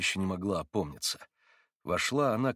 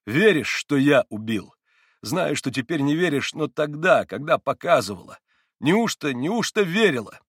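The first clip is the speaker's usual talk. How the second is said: loud, higher-pitched desperate questioning; urgent exclamation; 6 semitones higher, 8 dB louder than usual. A narrator's reading. Mitya asks Katya whether she believed, exclaiming urgently. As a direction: press for the answer, crying out. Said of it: "воскликнул вдруг Митя".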